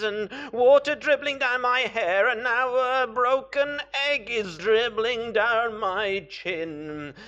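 hammed-up high, loud wail; crying. A narrator's reading. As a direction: bawl miserably, starting on a long drawn-out cry. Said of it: "he begins to cry".

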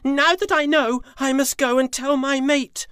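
high, loud, bursting with joy; thrilled and in a hurry to go; joyful tiger. Acted.

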